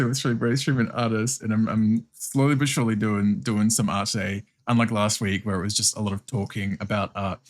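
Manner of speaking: normal talking voice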